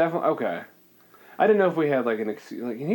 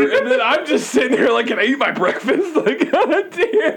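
high-pitched, incredulous protest; mock exasperation; 10 semitones higher, 4 dB louder than usual. Bystander just trying to eat breakfast, mock exasperated. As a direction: complain loudly while half laughing. Acting a startled lunch-table guest.

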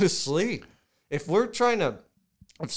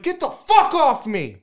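startled, high-pitched yell on waking; comic re-enacted outburst, very loud and high; angry scream; 12 semitones higher, 9 dB louder than usual. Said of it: "You're screaming".

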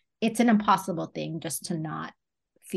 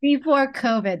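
higher, louder, amused repeat; amused echo; 6 semitones higher, 5 dB louder than usual. Real, not acted. Host repeating the daughter's line, amused. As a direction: savor the funny phrase. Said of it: "that's funny".

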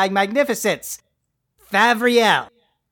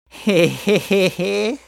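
slow villainous heh heh laugh; evil cackle; slower than usual. Acted. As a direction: cackle slowly like a cartoon villain. Villain gloating over being king.

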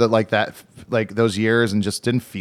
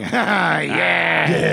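mock heckler; 3 semitones higher than usual.

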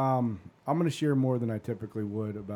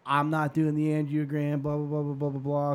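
imitated stubborn mom; 4 semitones higher than usual. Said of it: "Well, my mom is".

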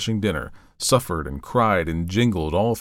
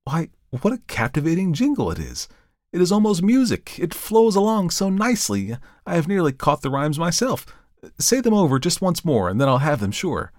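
light up with enthusiasm; delighted; 8 semitones higher than usual. A narrator's reading. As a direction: reverend charmed by the jingle. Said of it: "face lighted with interest".

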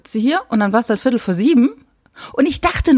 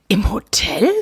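an incredulous hushed whisper; whispered 'Im Hotel?' in shock; stunned whisper; slower than usual. Retold or imitated. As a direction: breathe it out in disbelief, barely voiced. Incredulous reaction to him in hotel.

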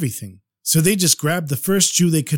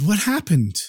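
plain reading voice

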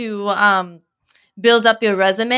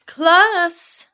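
higher, commanding teacher voice; stern teacher; 7 semitones higher than usual. Acted. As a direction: address the class from the front. Monologue as a teacher.

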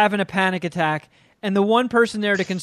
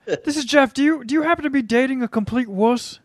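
mock phone call; 6 semitones higher than usual. Co-host impersonates Jeff on the phone.

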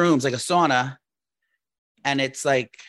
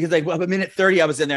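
normal talking voice

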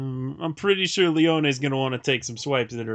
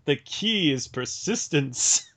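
ordinary delivery

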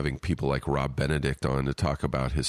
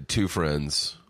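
ordinary talk, nothing marked

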